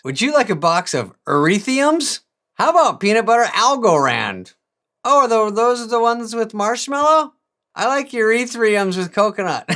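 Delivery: put-on high, loud sweet cookie-girl voice; Girl Scout seller. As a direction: sell cookies like an eager little girl.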